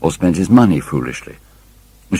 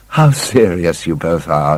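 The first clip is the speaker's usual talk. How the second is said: slower, lightly voiced girl's exclamation; light cry. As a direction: tease them, drawn out and bright-voiced. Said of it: "she cried".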